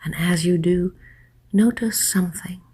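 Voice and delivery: guided hypnosis. Acted. Hypnotist guiding listeners to let their thoughts drift, soft hypnotic voice. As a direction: lull the listener gently, hushed and unhurried.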